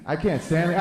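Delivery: ordinary talk, nothing marked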